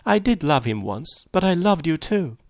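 high, tearful confession without real sobs; a touch of helpless distress, pitched high; helpless sobbing, understated. A narrator's reading. Daisy confesses to Gatsby she loved both, mildly tearful. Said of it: "She began to sob helplessly".

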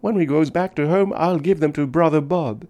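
put-on small child planning a gift; little girl. A narrator's reading. Little girl to the man about brother Bob, high hopeful child voice.

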